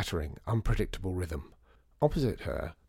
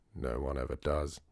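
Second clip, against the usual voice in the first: low, sorry-for-himself sigh of a line; a mild mournful mope; understated mournful complaint; 6 semitones lower, 3 dB quieter than usual. A narrator's reading. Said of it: "said the man mournfully".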